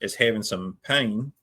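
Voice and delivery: Australian paramedic giving a report; Australian accent. Retold or imitated. Paramedic reporting to nursing staff in an Australian accent. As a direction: give the handover in an Australian accent. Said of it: "in the Australian accent".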